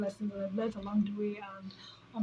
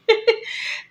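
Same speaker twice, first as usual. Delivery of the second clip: high, loud burst of laughter; 12 semitones higher, 9 dB louder than usual. Real, not acted. Amused at misstating the wedding date, laughing. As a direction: laugh at your own slip.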